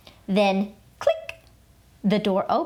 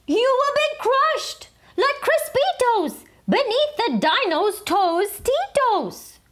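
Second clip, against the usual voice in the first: much higher squeaky little dog warning; high chihuahua voice; 14 semitones higher than usual. A narrator's reading. Skipito warning the Papitos, high pitched. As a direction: pitch it way up for the tiny hero.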